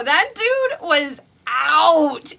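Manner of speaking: ordinary talk, nothing marked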